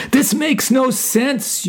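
high, slow panicked cry. A narrator's reading. Soul panicking before being sent down, high cry. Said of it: "you cried out".

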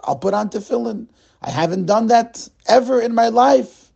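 put-on apologetic Russian-accented stammer. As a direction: play the eager Russian immigrant in a light Russian accent. Russian man accepts tefillin offer.

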